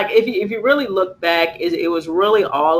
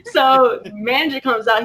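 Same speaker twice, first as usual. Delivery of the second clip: usual speaking voice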